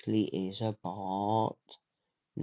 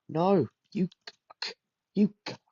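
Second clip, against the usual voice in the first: much higher, louder mocking yell; shrill taunting yell; 9 semitones higher, 4 dB louder than usual. Real, not acted. Gamer yelling at rival who passed him, high mocking yell. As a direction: squeal the insult right at him.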